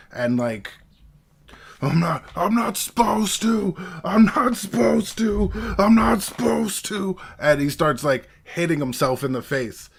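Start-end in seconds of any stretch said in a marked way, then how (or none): 1.8-7.2 high loud panicked whine; freaking out giant. Acted. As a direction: wail it like a panicking brute. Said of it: "freaking out".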